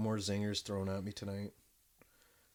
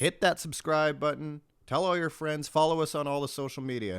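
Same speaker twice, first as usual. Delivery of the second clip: higher, louder, projected subscribe plug; podcast sign-off; 6 semitones higher, 5 dB louder than usual. Real, not acted. Host asking listeners to follow the show, projected sign-off. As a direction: pitch it to listeners like an announcer.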